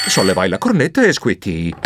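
ordinary delivery, nothing marked

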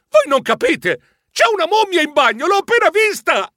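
high, urgent outburst; all in one breath. A narrator's reading. Narrator warns friends of a mummy, blurting breathlessly. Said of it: "dissi tutto d'un fiato".

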